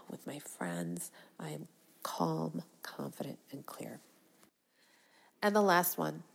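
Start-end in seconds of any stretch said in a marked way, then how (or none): 0.1-4.0 fading hushed whisper; whispered affirmation. Shown as a demonstration. Speaker closing her affirmation in a fading whisper. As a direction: let it drop to a whisper. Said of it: "affirmation fading to whisper".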